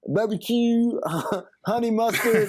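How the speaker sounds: mock annoyed Wendy's cashier listing sauces; impatient cashier. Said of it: "she's looking at me like I'm an idiot".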